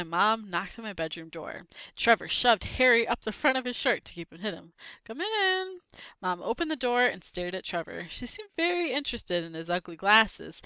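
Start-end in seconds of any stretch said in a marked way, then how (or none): 5.0-5.8 high voice. Acted. Answering mom at the door.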